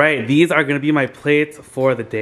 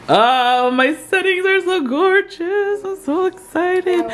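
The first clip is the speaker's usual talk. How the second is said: very high, slow sing-song delight; hosting vlogger squealing over her finished table; high excited gush; slower than usual. Real, not acted. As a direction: gush like a thrilled hostess. Gushing over table settings.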